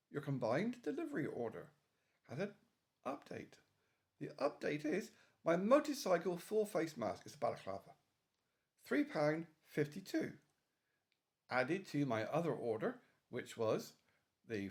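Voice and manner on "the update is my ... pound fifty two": normal talking voice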